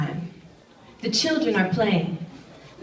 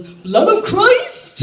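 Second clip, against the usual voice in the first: high, slow hammed-up proclamation; mock toast; 11 semitones higher, 6 dB louder, much slower than usual. Dad's friend raising shrimp wine, hammed-up high shout. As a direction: raise a glass and cry it out. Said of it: "lifts it in the air and goes".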